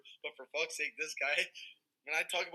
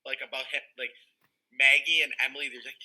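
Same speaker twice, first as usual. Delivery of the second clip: ordinary talk, nothing marked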